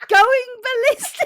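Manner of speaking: high, loud squeal of laughter; hysterical laughter. Real, not acted. Repeating favourite anger stage to co-host, shrieking with laughter. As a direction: shriek it out while cracking up. Cracking up over anger list.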